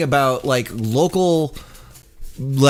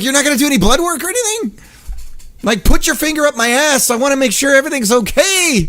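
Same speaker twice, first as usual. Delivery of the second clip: pleading patient; 10 semitones higher, 4 dB louder, faster than usual. Retold or imitated. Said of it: "And I'm like".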